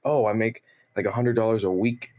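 ordinary talk, nothing marked

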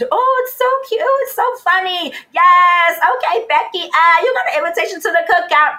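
high, loud, gushing mock voice of an outsider. Guest mocks outsiders to the podcast host, in a high, loud gushing voice.

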